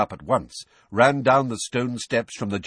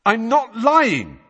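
high, loud, drawn-out denial; loud protest; 8 dB louder, slower than usual. A narrator's reading. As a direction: insist over the muttering court. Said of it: "said Harry loudly".

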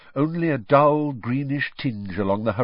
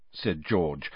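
ordinary delivery

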